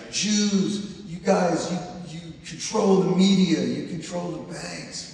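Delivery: a loud, pointed accusing rant; hammed-up accusing crank; mock accusation. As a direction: jab the finger like a conspiracy crank. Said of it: "everybody's always so mad, like".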